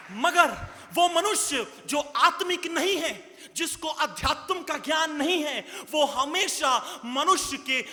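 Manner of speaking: usual speaking voice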